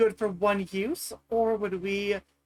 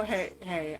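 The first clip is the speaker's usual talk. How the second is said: softly, a hesitant opening; quiet apology; 3 semitones lower, 9 dB quieter than usual. Acted. Character sidling over to apologize during haggling, quiet. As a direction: gently get their attention to apologize. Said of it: "a very quiet apology".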